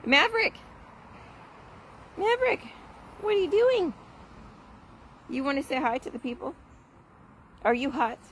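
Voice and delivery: high, sing-song voice for the dog; calling the dog in high pet talk. Real, not acted. Calling and talking to her dog.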